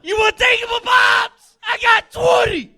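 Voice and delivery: squealing mermaid impression. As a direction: shriek it like a cartoon mermaid.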